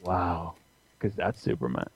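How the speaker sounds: hushed, soft wondering voice; quiet awe. Retold or imitated. Starfire silently seeing Superman's face, soft and hushed. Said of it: "Starfire going".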